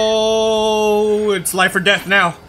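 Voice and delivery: loud, slow tense shout. Real, not acted. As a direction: yell it out with slow urgency. Tense game moment, shouting.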